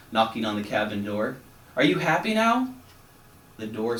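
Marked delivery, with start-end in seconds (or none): 1.8-2.7 high, bright rising question; hopeful, eager ask. Acted. Frog asks the grumpy captain, eager high question.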